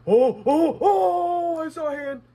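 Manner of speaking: high, loud cries of alarm; startled yelling. Real, not acted. Player spots a hand in the dark game, startled yelling. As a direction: yelp in fright, then blurt it out.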